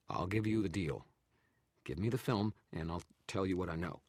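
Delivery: nervous haggling; bargaining photographer. A narrator's reading. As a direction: press for a trade. Narrator voices photographer.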